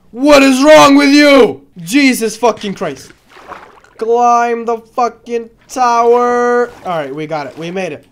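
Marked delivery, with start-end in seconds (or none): 0.1-6.7 slow, loud, exasperated yell; furious yelling. Real, not acted. Player yelling at the dragon, slow furious yell. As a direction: shout each word at the screen.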